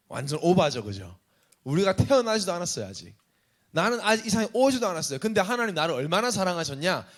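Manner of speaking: ordinary talk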